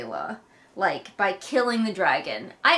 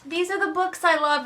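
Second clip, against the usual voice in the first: put-on girl showing off; 7 semitones higher than usual. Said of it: "a girl showing off".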